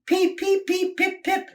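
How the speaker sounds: very high whistle-like peeps; an engine whistling for help, very high peeps; whistled peeps. Acted. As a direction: peep like a little train whistle. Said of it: "he whistled".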